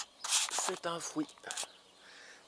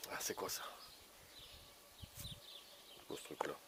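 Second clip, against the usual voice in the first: whispered puzzlement; 13 semitones lower, 24 dB quieter than usual. Real, not acted. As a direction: whisper as if stumped. Puzzled mutter over unknown plant.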